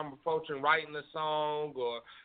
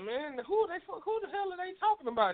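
surprised; 12 semitones higher than usual. Retold or imitated. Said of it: "I was like".

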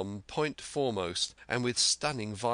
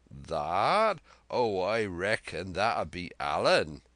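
louder, casual with a smile in it; smiling, wary; 3 semitones lower, 4 dB louder, slower than usual. A narrator's reading. Silver smiling warily as he names the dead man. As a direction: toss it off lightly, watching him. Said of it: "smiling away, but warier than ever".

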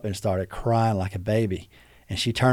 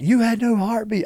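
imitating frantic wife; 9 semitones higher than usual. Acts out frantic wife insisting.

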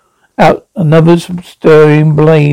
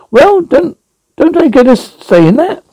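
shrill-high outrage; high indignant protest; 10 semitones higher than usual. Acted. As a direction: protest in disbelief.

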